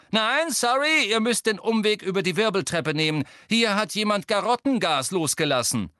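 loud high call. Acted. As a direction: warn the crowd loudly. Ginny warning the crowd.

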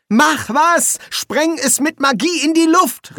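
high, loud cry for action; frantic high-pitched yell; calling out urgently. A narrator's reading. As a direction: shout it high, stretching the first words. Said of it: "rief Ginger".

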